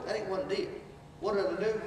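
ordinary delivery

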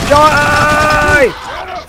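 loud high battle cry; action hero yell. Pretend. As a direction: yell it like Rambo. Playing Rambo, shooting.

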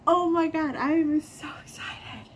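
loud high gasp into breathy excitement; excited shriek. Real, not acted. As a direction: burst out with joy. Thrilled unboxing reaction.

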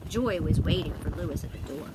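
ordinary delivery

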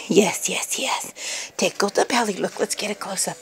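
whispered cooing; soft whisper. Real, not acted. To the puppy during belly tickles, soft whispered cooing. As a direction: hush it to the puppy.

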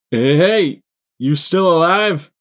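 high, loud call from a distance; thin shout. A narrator's reading. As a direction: shout down to someone far below. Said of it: "came a thin shout from above".

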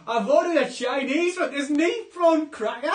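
very loud, high-pitched hammed-up Geordie; Geordie accent. Complaining about a Chinese takeaway order, in shouted Geordie.

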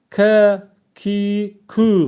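hard back-of-throat K. Shown as a demonstration. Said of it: "Koyunun K'siyle çıkaracağız".

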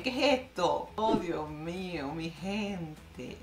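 ordinary delivery

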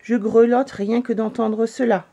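usual speaking voice

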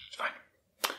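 breathy unvoiced whisper. Shown as a demonstration. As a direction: barely breathe the words. Repeated line, whispered take.

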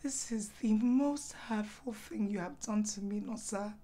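low, soft, wounded voice; quietly hurt. Acted. Wife hurt by husband's refusal, quietly wounded. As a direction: let the hurt pull your voice down.

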